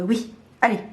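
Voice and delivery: ordinary delivery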